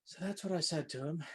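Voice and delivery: usual speaking voice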